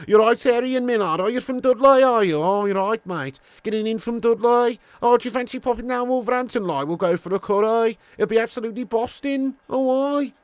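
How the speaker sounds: high-pitched, exaggerated Dudley accent; Brummie accent. Streamer teasing a viewer from Dudley, put-on Brummie accent. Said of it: "your Brummie accent is sound".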